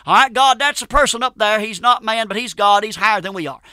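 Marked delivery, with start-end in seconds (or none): none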